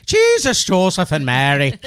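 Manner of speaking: loud, high-pitched Irish exclamation; hammed-up Irish cry; Irish accent. Playing up the Irish sound for friends, loud Irish accent. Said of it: "that sounded Irish".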